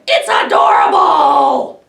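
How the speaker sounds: loud high shout of dismay; hammed-up horrified wail. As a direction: wail it in horror.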